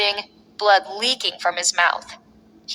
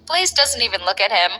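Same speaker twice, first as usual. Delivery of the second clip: ordinary delivery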